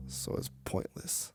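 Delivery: soft, slow and almost voiceless; [name] gives up on the argument, barely voiced; deflated murmur. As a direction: let the fight drain out of you. Defeated end of argument.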